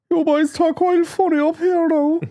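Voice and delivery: very high, drawn-out Cork singsong; mock Cork accent. Cork wedding guests teasing the locals, slow high Cork accent. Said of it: "the squad was from Cork".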